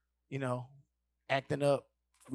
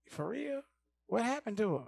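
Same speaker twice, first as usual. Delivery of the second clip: put-on higher startled girl; surprised girl; 8 semitones higher than usual. Girl reacting to Cat, lightly voiced high surprise.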